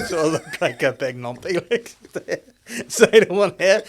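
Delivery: high, laughing through the words. Real, not acted. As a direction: giggle through it. Laughing at own helmet.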